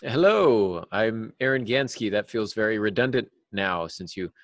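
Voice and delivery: ordinary delivery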